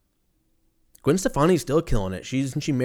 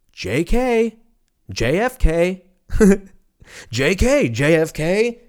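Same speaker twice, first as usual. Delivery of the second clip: higher and louder sing-song taunt; mock taunting; 8 semitones higher, 6 dB louder, slower than usual. Conspirators taunting JFK after his speech, loud sing-song. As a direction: tease it like a playground joke.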